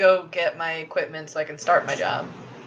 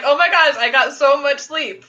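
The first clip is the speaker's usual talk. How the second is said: higher, gushing imitation; hammed-up amazement, higher pitch; mock delight; 5 semitones higher than usual. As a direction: gush like a thrilled morning person. Imitates friend thrilled by sleep.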